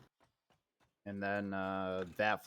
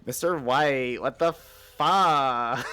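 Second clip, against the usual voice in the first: put-on loud, drawn-out valley girl outrage, slowly, laughing through it; valley girl Jesse; 4 semitones higher, 9 dB louder, much slower than usual. Riffing on Breaking Bad with a teammate, slow laughing valley girl yell. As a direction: stretch the swear out like a whiny valley girl while cracking up. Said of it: "Jesse was just replaced with a valley girl".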